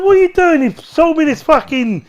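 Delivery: retold indignant complaint; mock outrage. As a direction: play up the disbelief. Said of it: "I said".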